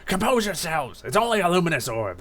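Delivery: stern rebuke. Acted. Dusknoir calming blinded underlings, stern command. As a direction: rein them in sharply.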